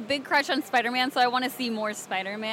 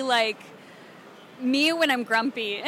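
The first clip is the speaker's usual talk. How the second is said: usual speaking voice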